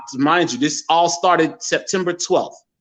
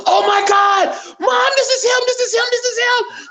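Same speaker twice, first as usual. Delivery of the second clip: excited screaming. Retold or imitated. Sister screaming to mom on the phone, overjoyed. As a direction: shriek it like she just found her lost brother. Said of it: "She was crying".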